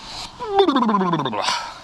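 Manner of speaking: high, rattling laugh that falls away; high cackle. Real, not acted. Host kicking off his how-to video, high cackle. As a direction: let out a long, falling cackle. Own laugh before the intro.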